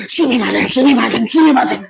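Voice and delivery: acted apology. Shown as a demonstration. Teacher showing how to really say sorry in Japanese, effusive apology. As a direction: apologize over and over as if meaning it. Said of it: "아니야!".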